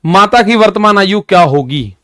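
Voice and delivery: ordinary delivery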